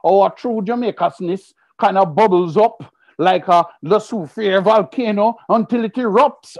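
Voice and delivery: normal talking voice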